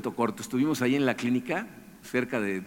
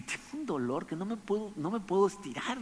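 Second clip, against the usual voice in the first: pained voice; 7 semitones higher, 7 dB quieter than usual. Retold or imitated. Doubled over telling the directors.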